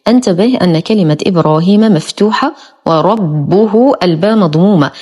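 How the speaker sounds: ordinary talk, nothing marked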